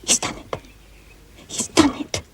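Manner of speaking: whispered disbelief; hardly daring to say it aloud; hushed thrilled whisper; breathless whisper. Acted. Sisters hear the proposal succeed.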